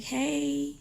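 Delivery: lightly voiced son's hello, pitched high; high drawn-out greeting; a hint of a child answering the phone; retold greeting.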